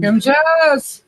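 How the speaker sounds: excited greeting. Real, not acted. Greeting a viewer by name.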